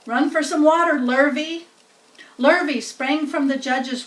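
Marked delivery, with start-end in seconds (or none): 0.1-1.7 higher, a hint of urgent ordering; urgent farmer. A narrator's reading. Farmer orders hand to fetch water, urgent. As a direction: send for water quickly.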